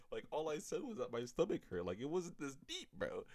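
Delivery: high-pitched, exasperated complaint; whiny protest. Retold or imitated. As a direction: plead that it's all been blown out of proportion. Acted protest at mom's overreaction.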